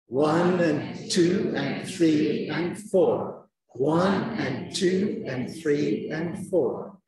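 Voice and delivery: slow, even beats, hit each number; stress-timed chant of numbers; stress timing beat. Shown as a demonstration. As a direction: count out the rhythm slowly, numbers stressed. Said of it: "it's called stress timing".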